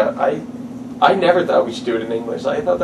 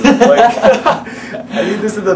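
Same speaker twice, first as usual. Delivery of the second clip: words burst out through a laugh, pitched up; 9 semitones higher, 4 dB louder, much slower than usual. Real, not acted. Laughing as he praises a friend's accent. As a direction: laugh while you start the sentence.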